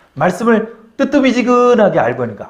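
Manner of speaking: normal talking voice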